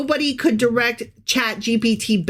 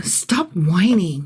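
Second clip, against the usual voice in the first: stern; 4 semitones lower, slower than usual. Acted. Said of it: "you be Amazon".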